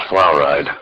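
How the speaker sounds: fluoride said as "FLOUR-ide", falling; fluoride with an "ow" first syllable; wrong "flour-ide" pronunciation. Shown as a demonstration. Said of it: "It's not fluoride".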